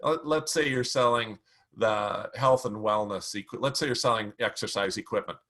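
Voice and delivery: usual speaking voice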